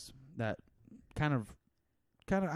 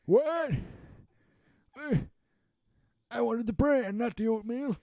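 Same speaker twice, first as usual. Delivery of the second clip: put-on high, loud old-timer voice; hammed-up old geezer demanding bran; cranky old person; 7 dB louder than usual. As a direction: squawk like a grumpy nursing-home resident. Silly old-person voice bit.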